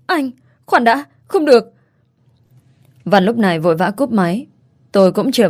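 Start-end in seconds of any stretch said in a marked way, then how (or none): 0.1-1.7 kêu lên hoảng hốt, giọng cao; desperate cry. A narrator's reading. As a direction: cry out before he hangs up. Mother begging him to wait.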